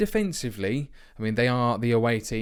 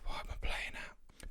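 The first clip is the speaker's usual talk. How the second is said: whisper; 13 dB quieter than usual. Real, not acted. Muttering to himself mid-game.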